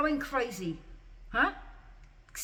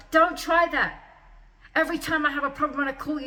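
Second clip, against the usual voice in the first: louder, insistent, hammering each phrase; angry rant; 3 semitones higher, 3 dB louder than usual. Real, not acted. Angry rant at the hospital staff.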